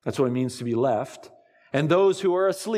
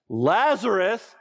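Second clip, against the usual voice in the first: high ringing call into the tomb; loud cry; 7 semitones higher, slower than usual. Acted. Jesus at the tomb calling his dead friend, loud and high. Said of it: "he cried out in a loud voice".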